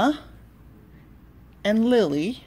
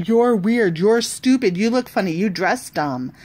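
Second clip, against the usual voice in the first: louder playground jeers; rude insults; 4 semitones higher, 6 dB louder than usual. Acted. Kids insulting each other, louder jeering. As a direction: fling the insults.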